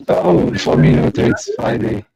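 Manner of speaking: garbled voice-changer sound, high and whispery; glitchy stream audio like a supervillain; distorted villain voice. Real, not acted. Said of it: "Oh, you sound like Bane or something".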